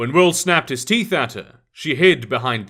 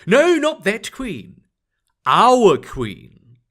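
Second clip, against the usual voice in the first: conspiratorial; 5 semitones higher, 4 dB louder, much slower than usual. A narrator's reading. Fairy correcting the shopkeeper.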